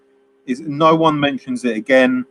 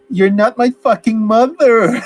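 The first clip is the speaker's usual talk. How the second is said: higher, louder, slow mock yell; mock angry outburst; 8 semitones higher, 5 dB louder, slower than usual. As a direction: ham up the teenage outburst at her. Denny snaps at Lisa's mother.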